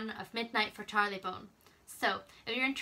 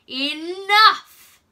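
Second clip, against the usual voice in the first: booming, higher, very loud shout of Enough!; chilling command; 9 semitones higher, 17 dB louder than usual. Acted. Mystery man silencing the arguing crowd with a chilling shout. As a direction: thunder the word Enough! to silence everyone. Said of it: "such a deep and chilling voice".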